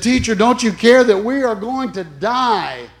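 a milder replay of the disciples' panic; panicked cry. Retold or imitated. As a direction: plead in alarm. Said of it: "And they said".

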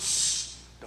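drawn "shh"; hushing. Acted. Preacher acts out Jesus hushing the apostles, hushing. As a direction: hush them with a shh.

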